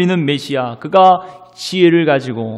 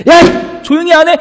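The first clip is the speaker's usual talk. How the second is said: high, loud angry hushing; shouted rebuke; 14 semitones higher, 7 dB louder than usual. Acted. Crowd scolding the persistent beggar, shouting. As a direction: yell at him to shut up. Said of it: "계속 그를 핀잔하고".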